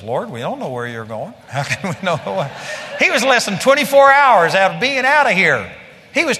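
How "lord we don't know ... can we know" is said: put-on dim disciple voice, lower; clueless disciple. Preacher mimicking Philip answering the Lord, mock clueless.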